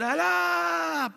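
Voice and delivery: high, loud cry stretched out long; imitated shouting prayer. Preacher mimicking a call to a distant God, long shout. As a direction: call out as if to someone far away. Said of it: "Porque su Dios está lejos".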